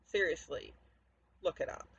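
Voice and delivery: normal talking voice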